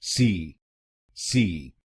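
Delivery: सी alone as "see"; isolated syllable. Shown as a demonstration. Teacher drilling the last syllable, sounded out. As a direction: sound out सी by itself. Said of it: "आखिरी शब्दांश को बोलिए".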